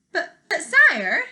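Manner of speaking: high, loud, slow stammering protest; flustered duke. Acted. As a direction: stammer out a nervous objection.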